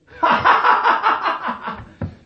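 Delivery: bursts into a high, loud laugh. Real, not acted. Teacher laughs at own joke.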